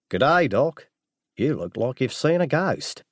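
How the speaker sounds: breezy Aussie greeting; strong Australian accent. Acted. Disguised assassin greeting the doctor, strong Australian accent. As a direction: greet him in broad Australian. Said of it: "in a strong Australian accent".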